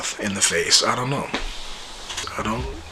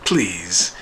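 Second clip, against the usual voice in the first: ordinary talk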